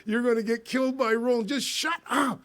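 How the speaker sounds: high, firm telling-off, half laughing; stern order. Retold or imitated. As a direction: shock him into silence. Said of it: "I said".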